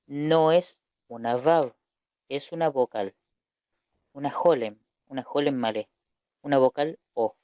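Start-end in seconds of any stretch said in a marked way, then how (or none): none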